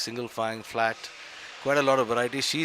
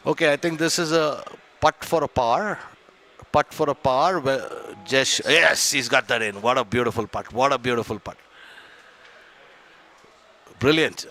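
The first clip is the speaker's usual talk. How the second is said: excited golf commentator; 5 dB louder than usual. Real, not acted. Live call of a made putt.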